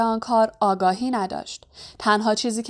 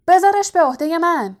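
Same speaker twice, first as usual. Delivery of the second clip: high, loud call to take over; shouting; 8 semitones higher, 5 dB louder than usual. A narrator's reading. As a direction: shout it across the hall. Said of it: "لاکهارت فریاد زد". (با فریاد)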